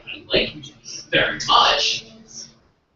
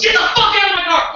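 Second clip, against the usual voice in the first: high, loud yell imitating his date; mock-gentle retold yell; 8 dB louder than usual. Date ordering him out of her car, imitated yell. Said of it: "And very gently, she goes".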